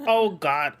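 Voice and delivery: put-on slow, loud, high Northern Irish accent; thick Northern Irish accent. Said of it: "that guy had a thick accent".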